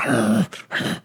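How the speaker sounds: imitated snarling growl; dog-like growl. Old customer snarling at the worker, imitated growl. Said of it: "fucking growled at me".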